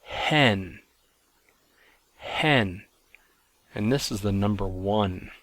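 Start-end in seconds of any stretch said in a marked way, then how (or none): 0.1-0.8 "hen." with a breathy h before the vowel; rough-breathing sound. Shown as a demonstration. Teacher showing students the rough breathing: "hen." with h. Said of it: "it's pronounced". 2.2-2.8 "hen." with a breathy h before the vowel; rough-breathing sound. Shown as a demonstration. Teacher showing students the rough breathing: "hen." with h. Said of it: "it's pronounced".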